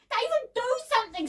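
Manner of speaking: high, loud, panicky cry; girl's urgent scream. A narrator's reading. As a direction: scream for help, voice way up. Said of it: "screamed Debbie".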